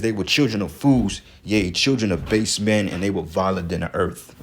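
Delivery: reading scripture with loud emphasis. Acted. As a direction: proclaim the verse to the congregation.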